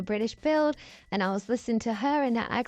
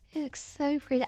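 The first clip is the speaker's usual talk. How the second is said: higher and softer, through a giggle; softly laughing with delight, higher voice; 5 semitones higher, 5 dB quieter than usual. Real, not acted. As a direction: laugh gently while admiring it. Admiring her build, laughing softly.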